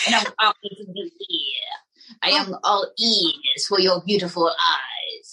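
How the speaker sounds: breathy whisper with a French lilt; whispered French flirt. Acted. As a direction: whisper sweet nothings like a French lover. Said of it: "Monsieur Mortimer".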